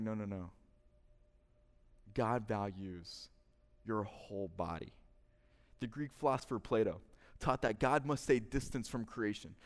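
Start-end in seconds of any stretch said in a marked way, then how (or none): 0.0-4.9 lightly voiced John. Voicing John rebutting Greek philosophy.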